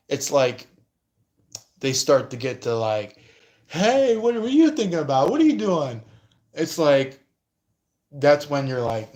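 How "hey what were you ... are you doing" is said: high, loud, nosy questioning voice; prying busybody. Retold or imitated. Nosy third party prying into private life, high and pushy. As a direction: pester them like a nosy busybody.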